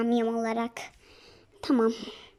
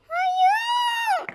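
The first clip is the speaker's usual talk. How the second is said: a captive's shrill cry of no; shrieking no. Acted. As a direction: scream it out in terror. Captive cries out.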